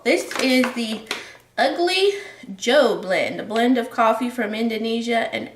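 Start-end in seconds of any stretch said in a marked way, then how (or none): none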